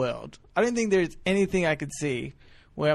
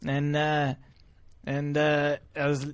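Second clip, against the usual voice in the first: ordinary talk, nothing marked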